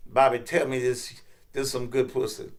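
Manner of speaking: low, coaxing bedroom voice; seductive lover. Acted. Kim questioning Bobby in bed, low and coaxing.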